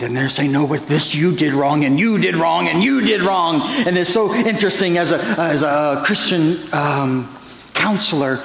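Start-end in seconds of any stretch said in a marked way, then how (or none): 0.5-3.6 hammed-up quarrel, jabbing each "you"; mock accusing spouse.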